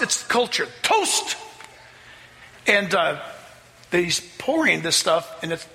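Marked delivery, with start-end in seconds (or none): 0.8-1.4 much higher, ringing call of Toast!; old man's toast call. Retold or imitated. Old father calls the family to toast, ringing high call. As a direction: raise a glass and cry out Toast! like the proud old father. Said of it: "he calls a toast".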